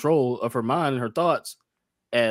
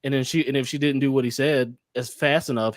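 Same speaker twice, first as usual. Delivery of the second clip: normal talking voice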